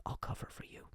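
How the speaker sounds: soft conspiratorial whisper; whispered secret. Retold or imitated. The client promising in a whisper to cover. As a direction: hush it like a shared secret. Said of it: "And he's like".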